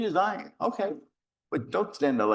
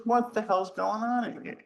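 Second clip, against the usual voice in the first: retold exasperated question; 12 semitones higher than usual. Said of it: "He says".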